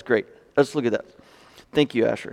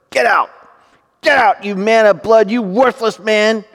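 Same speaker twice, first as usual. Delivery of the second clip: forceful, raised voice of denunciation; cursing; 4 semitones higher, 6 dB louder than usual. Acted. Preacher reading Shimei's curse on King David, heated and forceful. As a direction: read it as a mild acted tirade. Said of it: "Shimei said as he cursed".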